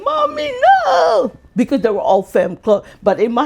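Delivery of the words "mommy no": high wailing mock sob of protest; hammed-up pleading cry; fake crying. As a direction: whine it like a child faking tears. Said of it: "I had to pretend to be crying".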